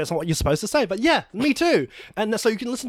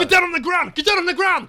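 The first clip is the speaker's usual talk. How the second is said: high, loud police bark; cop shouting; 11 semitones higher, 5 dB louder than usual. Acted. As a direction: yell orders at a suspect. Police raid.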